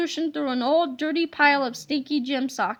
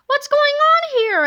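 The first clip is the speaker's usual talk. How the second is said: put-on much higher, louder worried kid voice; suspicious kid; 10 semitones higher, 6 dB louder than usual. A narrator's reading. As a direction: ask warily, on guard.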